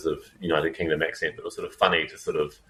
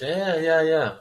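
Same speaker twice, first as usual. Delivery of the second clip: mock nod and smile; 10 semitones higher, much slower than usual. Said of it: "the nod, nod and smile approach".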